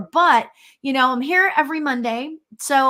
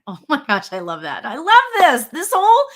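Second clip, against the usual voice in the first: louder, higher burst of delight; excited gush; 3 semitones higher, 6 dB louder than usual. Real, not acted. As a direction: gush about how fast the hour went, slowing at the end. Host gushing at end of show.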